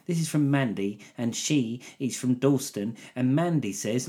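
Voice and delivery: Jeremy Corbyn. Acted. Mimicking an MP introducing a constituent's email, earnest impression. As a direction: plod through it as an earnest politician. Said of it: "'Cause he was going".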